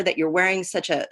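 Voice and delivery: ordinary delivery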